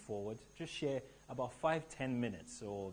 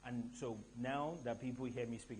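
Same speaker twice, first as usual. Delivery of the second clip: normal talking voice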